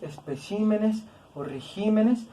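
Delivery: "especímenes o regímenes" slow and soft, with stress on CÍ and GÍ; shifted stress. Shown as a demonstration. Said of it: "el acento se recorre".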